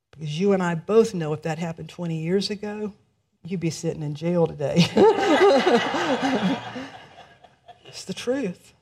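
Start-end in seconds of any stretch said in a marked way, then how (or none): 4.9-7.0 short high laugh at the joke. Real, not acted. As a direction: laugh along with the room.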